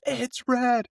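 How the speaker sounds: mock tearful high-pitched protest; imitated crying. Crying girl out her window to honking driver, mock wail. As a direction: squeal it like an upset girl, hammed up. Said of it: "And she's crying".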